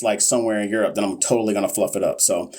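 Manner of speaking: ordinary talk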